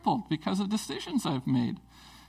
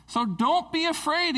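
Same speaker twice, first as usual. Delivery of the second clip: high, lifted "So don't be afraid"; bright high call of "don't be afraid"; reassuring king's words; 11 semitones higher than usual. Retold or imitated. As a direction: lift "afraid" up high to comfort. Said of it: "he says".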